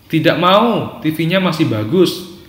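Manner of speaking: put-on high-pitched mild child's protest. Child refusing to switch off the TV, lightly voiced protest. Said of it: "Kalau dia menjawab".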